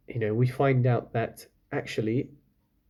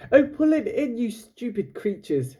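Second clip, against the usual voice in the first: high, loud exasperated cry; 11 semitones higher, 4 dB louder than usual. Acted. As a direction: yell it at the clumsy fishermen. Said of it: "Lila cried".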